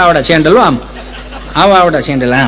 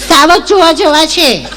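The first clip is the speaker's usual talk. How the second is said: a higher, mincing educated lady; prim city lady; 8 semitones higher, slower than usual. Retold or imitated. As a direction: mimic a fussy city woman picking each word. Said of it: "Gujarati ચીપી ચીપીને બોલે". (શહેરી બેનની ચીપી ચીપીને બોલતી નકલ)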